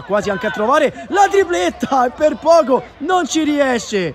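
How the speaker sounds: high, loud near-goal shout; excited near-miss. Real, not acted. Near hat-trick chance.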